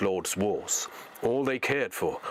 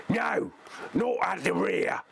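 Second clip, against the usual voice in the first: much higher barking orc; orc shouting; 10 semitones higher than usual. Acted. Slave driver orders them forward, high orc bark. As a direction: bark the correction. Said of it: "the slave driver shouted".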